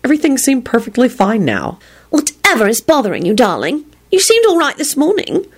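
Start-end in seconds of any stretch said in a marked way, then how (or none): 2.1-5.5 put-on high, fretting girlfriend voice; worried. A narrator's reading. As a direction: fuss over your lover with concern. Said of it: "Solange said worriedly".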